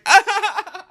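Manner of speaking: high-pitched mock hysterics; mock hysterical outburst. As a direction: imitate a movie heroine's shrill outburst. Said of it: "like, the scene in Scary Movie where she's like".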